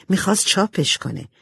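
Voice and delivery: ordinary delivery, nothing marked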